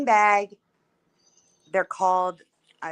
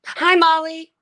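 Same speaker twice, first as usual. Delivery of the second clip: excited; 10 semitones higher, 8 dB louder, slower than usual. Real, not acted. Cheerful echoed greeting to chatter.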